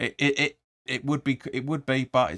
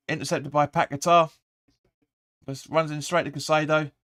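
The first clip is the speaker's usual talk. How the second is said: ordinary delivery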